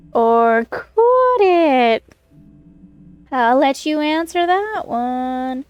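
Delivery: slow, ominous villain voice; slow theatrical villain menace. Pretend. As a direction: drawl it out like a movie villain. Spooky teasing of the new colleague.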